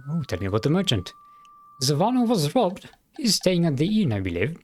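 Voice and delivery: put-on the sheriff in a mild German accent. Sheriff telling the adventurer about the town, light German accent. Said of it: "me trying to do a German accent".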